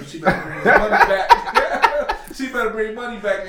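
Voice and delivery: laughing through the words; high laughing voice. Real, not acted. Laughs while quoting.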